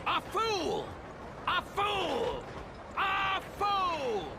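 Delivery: from high outburst to full shout; building angry shout. Acted. Racist calls stranger a fool.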